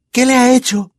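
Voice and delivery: high, anxious question; Hermione's frightened question; worried girl. A narrator's reading. Hermione asks Harry about Ron, high and anxious. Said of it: "preguntó Hermione".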